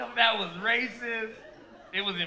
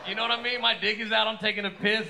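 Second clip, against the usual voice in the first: ordinary delivery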